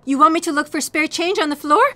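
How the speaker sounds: usual speaking voice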